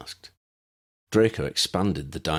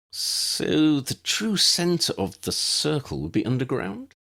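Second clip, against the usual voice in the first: slow, unsure question; tentative, slow wondering aloud; 8 semitones higher, slower than usual. A narrator's reading. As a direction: feel your way through the idea. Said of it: "said tentatively".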